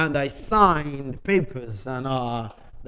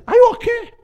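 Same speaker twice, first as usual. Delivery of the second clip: much higher and louder are you okay; energetic concern; 12 dB louder, faster than usual. Retold or imitated. Energetic fellow passenger asking him, a very high loud voice. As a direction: burst it out like an eager excited man. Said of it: "he said".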